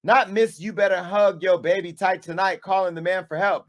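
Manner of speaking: ordinary talk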